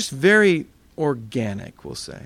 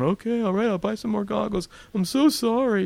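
higher, meek sorry-sorry voice; sheepish apology; 6 semitones higher than usual. Retold or imitated. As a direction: grovel a little to the doctor. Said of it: "I'm apologizing to the doctor".

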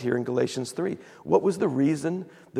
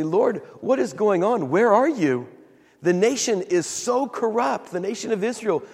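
higher-pitched, exasperated plea to God; mad prophet; 6 semitones higher than usual. Retold or imitated. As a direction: imitate an indignant prophet's complaint. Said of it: "and he's mad".

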